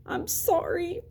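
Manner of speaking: high, soft, teary apology; sniffling apology. A narrator's reading. Augie crying to his mom in bed, sniffling and soft. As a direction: choke it out between sniffles. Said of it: "I said between sniffles".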